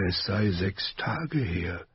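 slow, whispered weak words; weak Ned whispers to his captain. A narrator's reading. (schwaches Flüstern, langsam)

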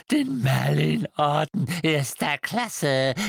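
croaking elf. A narrator's reading. Creature grieving to Harry over stolen heirlooms. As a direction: lament each lost treasure, rising.

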